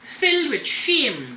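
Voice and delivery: usual speaking voice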